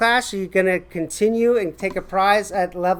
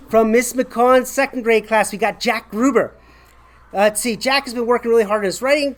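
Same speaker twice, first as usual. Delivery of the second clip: ordinary talk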